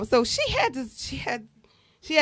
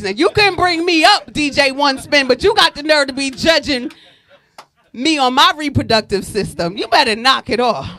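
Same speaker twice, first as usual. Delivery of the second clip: louder, raised, sassy call-out; mock scolding the DJ; 7 dB louder than usual. Real, not acted.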